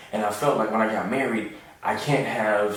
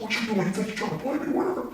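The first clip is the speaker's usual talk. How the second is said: high, mock-angry boyfriend cursing her out; angry dude on the phone; 12 semitones higher, 3 dB quieter, faster than usual. A jealous man on the phone at 2am, mocked high and angry. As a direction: squeak out the jealous guy's rant. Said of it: "I can't get a dude cursing her out".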